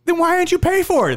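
high, incredulous mock outburst; indignant challenge. Big guy to thief who had the money, indignant challenge. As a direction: imitate an exasperated captor demanding an answer.

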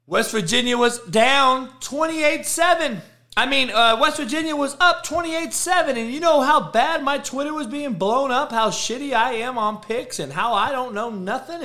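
high, loud, indignant rant; high-pitched, heated recounting of the pile-on; worked-up retelling. Real, not acted. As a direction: crow it high and loud, riled up. Heated retelling of online abuse.

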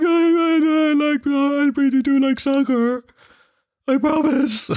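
put-on high, tearful little boy pleading; high squeaky kid promising; child's voice. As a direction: plead like a scared nine-year-old. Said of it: "So I was like".

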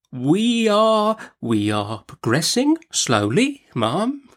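slow, hesitant timid doctor; meek doctor. A narrator's reading. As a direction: feel your way timidly through the answer. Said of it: "in his meekest manner".

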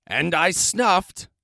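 drawn-out rising buildup; building to a sneeze. A narrator's reading.

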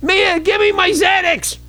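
panicked cry, high and slow; frantic high shout. Pretend. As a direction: beg for pills in a high yelp. Frantic joke after told slow down.

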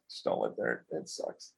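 very low, soft confiding warning; hushed aside. Real, not acted. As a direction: drop to a near whisper. Confiding a low opinion of apartments.

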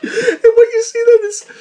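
talking through laughter; can barely get the words out for laughing. Real, not acted. Cracking up at the ad.

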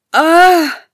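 loud, high cry of "ah"; merchant's horrified outcry; anguished cry. A narrator's reading. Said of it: "cried the merchant".

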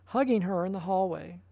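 ordinary delivery

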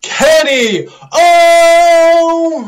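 long drawn-out arena announcer shout; ring announcer. Pretend. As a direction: stretch the name out like a ring intro. Ring announcer call after Justin Roberts.

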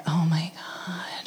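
breathy hushed "oh my god" in awe; awed whisper. Retold or imitated. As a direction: whisper it in stunned wonder. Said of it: "you're like".

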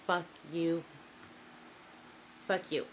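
ordinary delivery

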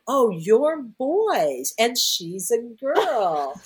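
high, very slow, drawn-out teasing; knowing tease. Retold or imitated. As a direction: spell it out slowly to the jealous dolphins. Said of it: "and I went".

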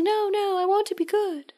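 high mock-pleading girl voice; lightly played desperate plea, pitched high; pleading Drew. Recapping the show to a friend, high mock plea.

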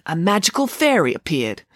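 plain reading voice

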